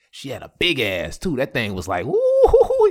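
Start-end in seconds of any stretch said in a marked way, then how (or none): 2.0-2.9 much higher, louder whoop; excited whoop. Real, not acted. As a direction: let out an admiring woo. Admiring exclamation.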